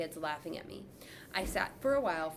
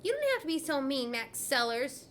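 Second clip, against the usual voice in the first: higher, whining complaint; whiny girl; 6 semitones higher than usual. A narrator's reading. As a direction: whine at the mean boy. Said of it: "Chloe whined".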